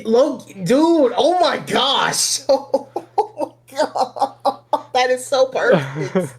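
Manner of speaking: high, drawn-out laughing oh my God; delighted disbelief. Real, not acted. Hearing a hilarious comparison.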